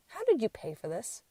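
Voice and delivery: soft, even, unbothered question; asked calmly and softly; mild imitation of a calm husband; calm quiet question. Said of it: "calm, quiet voice".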